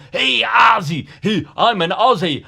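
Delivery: loud high put-on English accent; mock English accent. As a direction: ham up an Englishman greeting an Aussie. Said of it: "doing, like, an impression".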